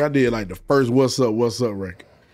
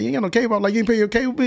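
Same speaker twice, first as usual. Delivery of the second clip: incredulous question; 9 semitones higher, much faster than usual. Retold or imitated.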